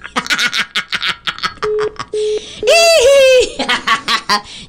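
long high laugh at the failed call; high burst of laughter. Real, not acted. Host laughing after the caller hangs up, high and long. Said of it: "no se entendía".

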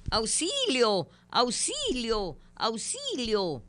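slow, high, drawn-out calls for help; hammed-up plea, stretching each auxilio; mock cry for help. Host acting a drowning man before the joke, slow high mock cry for help.

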